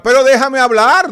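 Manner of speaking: loud, high insistent shout; shouting match. Real, not acted. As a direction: yell to get the floor back. Guest demands to speak.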